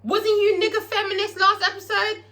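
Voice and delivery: high, teasing callout; mock scolding. The vlogger teasing Big Teak about his hypocrisy. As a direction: call him out, mocking. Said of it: "and I'm like".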